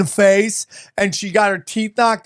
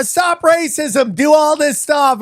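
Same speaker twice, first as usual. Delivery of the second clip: mock protester; 7 semitones higher, slower than usual. Mimicking virtue-signaling protesters.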